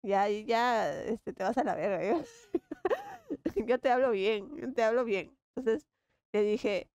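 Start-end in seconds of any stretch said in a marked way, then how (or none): 2.5-3.6 a squeal of laughter; high laugh. Real, not acted. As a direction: shriek of a laugh. Laughing after the curse. (risa muy aguda)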